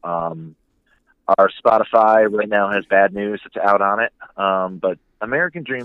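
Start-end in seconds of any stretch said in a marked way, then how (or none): none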